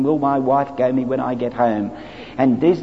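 ordinary talk

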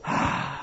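soft contented ahh after drinking; satisfied sigh. Shown as a demonstration. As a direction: breathe out, fully satisfied. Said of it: "you've got to be very satisfied".

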